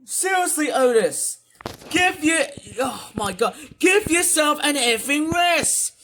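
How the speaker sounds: high, loud, exasperated rant; real angry shouting. Real, not acted. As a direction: shout it fed up, drawing out the key words. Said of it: "I'm sorry if I have to sound so angry".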